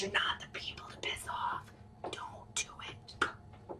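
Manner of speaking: hushed, conspiratorial whisper, all breath; mock-ominous whisper, slowing at the end; whispered warning. Mock warning about angering fairy folk.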